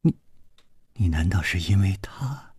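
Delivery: unvoiced whisper, barely breathed; hushed question. Woman whispers a suspicious question to her rival. Tense confrontation on the boat.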